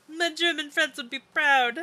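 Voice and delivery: high, slow, happy exclamation; pleased pride. Real, not acted. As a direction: draw it out high and proud. Pleased after praise of their German.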